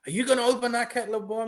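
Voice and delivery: high, quick challenging question; mock confrontation. Acts out her challenging him.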